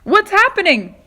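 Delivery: high, loud startled question; alarmed cry. Acted. James waking to the earthquake, high loud alarmed cry. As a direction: cry out in confusion. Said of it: "cried James".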